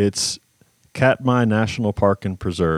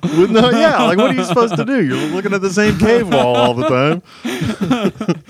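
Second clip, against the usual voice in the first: high excited whine; 10 semitones higher, 4 dB louder than usual. Pretend. Mock bored bear complaint.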